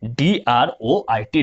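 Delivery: droit spelled slowly, letter by letter; spelling out. Shown as a demonstration. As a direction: spell out ड्रोएट slowly for the class. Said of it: "next ड्रोएट". (अक्षर-अक्षर धीरे spelling)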